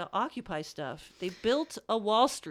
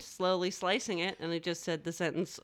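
normal talking voice

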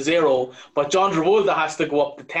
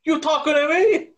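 De Niro; 10 semitones higher than usual. Retold or imitated. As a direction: throw the famous line like a hammed-up De Niro. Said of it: "you were doing it as De Niro".

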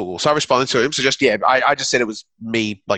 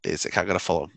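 usual speaking voice

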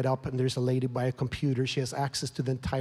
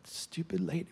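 muttered anger; 9 dB quieter than usual. Retold or imitated.

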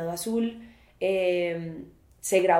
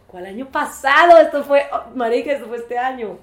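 surprised; 4 semitones higher, 11 dB louder than usual. Real, not acted. Correcting herself in disbelief.

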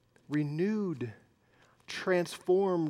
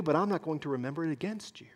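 hushed, quick whisper; gentle whisper; 8 dB quieter, much faster than usual. Pretend. Pastor voicing forgiveness to an offender, in a whisper. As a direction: breathe it softly to the one who wronged you.

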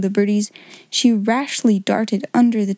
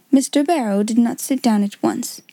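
ordinary delivery, nothing marked

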